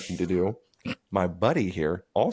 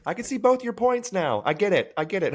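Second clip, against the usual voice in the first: mock scream; 12 semitones higher than usual. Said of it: "he'd always scream".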